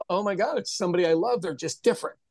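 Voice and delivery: ordinary talk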